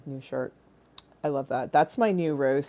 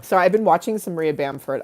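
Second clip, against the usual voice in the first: usual speaking voice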